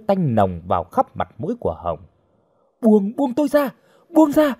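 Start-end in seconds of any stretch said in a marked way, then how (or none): 2.8-4.5 very high-pitched cries to let go; panicked. A narrator's reading. Captive fighting the man gripping her, panicked. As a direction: cry out while struggling free. Captive struggles in attacker's grip.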